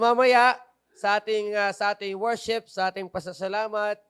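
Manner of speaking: ordinary talk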